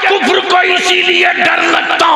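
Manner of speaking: high, loud thundering sermon; preaching at full force. Acted. Preacher rousing the gathering, impassioned shouting. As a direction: hammer the point home to the crowd.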